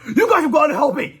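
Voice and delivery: high, loud panicked plea; panicked cry. Acted. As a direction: beg for help in a panic. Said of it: "he cried".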